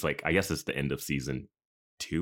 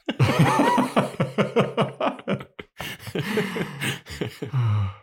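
loud high laugh; 5 dB louder than usual. Real, not acted. Big laugh at Love Actually.

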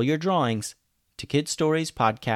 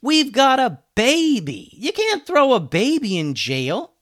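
desperate protest; 8 dB louder, slower than usual. Acted.

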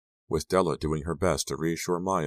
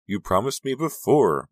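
higher, louder tearful accusation; upset friend, near tears; hurt reproach; 7 semitones higher, 6 dB louder than usual. A narrator's reading. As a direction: throw the broken promise back.

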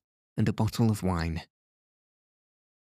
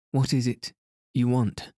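higher, nervous frightened question; fearful stammer; 4 semitones higher than usual. Acted. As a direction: ask it scared and shaken. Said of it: "stammered Victor, fearfully".

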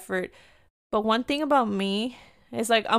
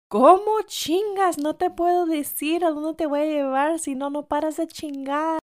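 high-pitched imitation of a fed-up father; mock parental exasperation, pitched well up; exasperated dad scolding; 7 semitones higher than usual. Exasperated dad scolding his child.

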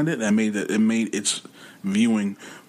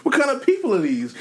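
pitched way up, baffled and disbelieving; incredulous mock voice; 3 dB louder than usual. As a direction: play the stunned official who can't believe the roster. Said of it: "He's like".

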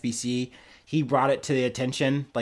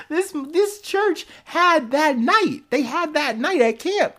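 high, slow, laughing through the words; can't believe it, laugh it out; laughing disbelief; 14 semitones higher, 3 dB louder, slower than usual. Real, not acted. Host laughing in disbelief at the church camp story, high and slow.

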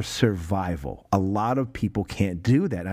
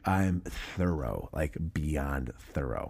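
slow, softer, deliberate; slow boast; 9 semitones lower, 8 dB quieter, slower than usual. Retold or imitated. German officer boasting of his thoroughness, slow and soft. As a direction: drag it out, savoring each word.

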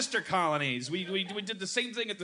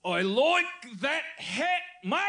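mock Australian; 9 semitones higher, slower than usual. Comedian demoing his one practised Australian line. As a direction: drawl it like a proud amateur Aussie. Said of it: "here's what I've learned".

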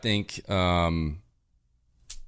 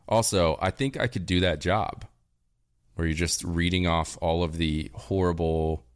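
usual speaking voice

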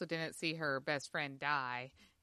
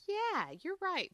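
mock realization; 13 semitones higher, slower than usual. Quoting own reaction.

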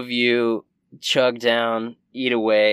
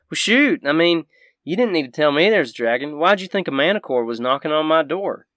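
animated country voice, pitched up; feisty Southern shopkeeper; 5 semitones higher, 6 dB louder than usual. Acted. Shopkeeper refusing to go back to town, feisty and folksy. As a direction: play a feisty backwoods woman.

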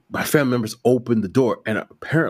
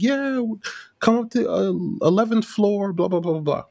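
raised relative's voice; high-pitched relative voice; 10 semitones higher than usual. Retold or imitated. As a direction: voice the relative higher. Family giving floor number.

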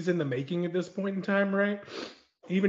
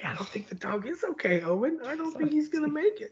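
worried viewer; 10 semitones higher than usual. Retold or imitated. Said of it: "You're like".

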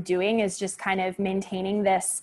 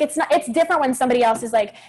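usual speaking voice

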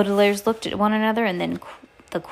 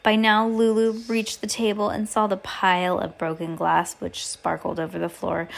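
ordinary delivery

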